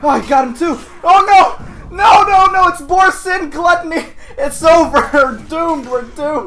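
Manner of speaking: high, loud hammed-up wailing; mock doomsday panic. Gamer facing silly-named clan kids, mock terror. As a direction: pretend all hope is lost.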